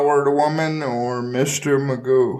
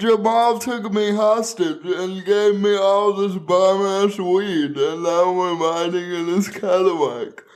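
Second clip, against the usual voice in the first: higher, pleading mock victim; mock helpless hostage; 7 semitones higher than usual. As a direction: ham up a helpless cry for help.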